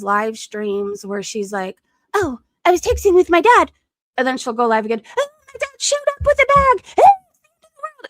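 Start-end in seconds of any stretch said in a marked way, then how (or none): 2.1-3.7 high, loud mocking impression; hammed-up livestream drama voice; mock livestreamer. Mimicking Specs on her live stream. 5.2-8.1 very high, loud mock wail; hammed-up hysterical livestreamer; Specs. Mimicking Specs's live-stream drama.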